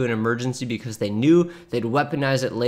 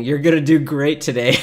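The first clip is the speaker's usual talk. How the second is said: cheery fridge note; 5 dB louder than usual. Pretend. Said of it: "Little word bubble saying".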